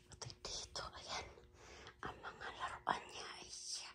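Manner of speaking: soft unvoiced whisper. Real, not acted. Whispering so the grandchild sleeps.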